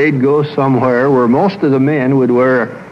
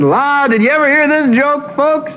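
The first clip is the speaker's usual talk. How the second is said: much higher, hammed-up hearty joker calling out; joke-telling backslapper; 12 semitones higher than usual. Husband working the deck crowd, hammed-up joker voice. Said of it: "That kind of a fella".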